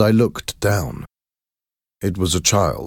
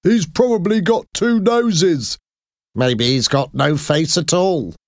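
higher, sneering gossip; mocking bystanders; 11 semitones higher than usual. A narrator's reading. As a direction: laugh at the freak with cruel curiosity. Crowd members mock him.

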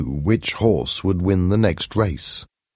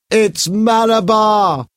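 high, slow, loud cry; powerful strange shout; 6 dB louder, slower than usual. A narrator's reading. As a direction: call it out in a trance. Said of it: "Paul shouted in a powerful, strange voice".

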